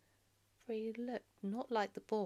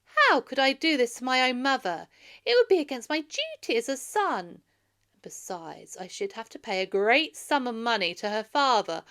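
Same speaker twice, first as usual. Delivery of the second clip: loud, higher indignant protest; indignant refusal; 5 semitones higher, 10 dB louder than usual. Acted. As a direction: refuse firmly, hurt and angry. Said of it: "Then said Telemachus".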